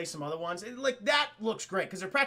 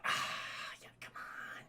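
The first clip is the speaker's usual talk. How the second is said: whisper; 12 dB quieter, slower than usual. Retold or imitated. Ben voiced in retold scene.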